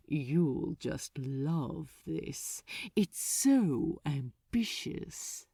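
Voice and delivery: low, very slow and savoring; slow archdemon. Acted. Archdemon teasing Crowley with his orders, slow and low. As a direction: drawl it out with wicked relish. Asmodeus voiced by reader.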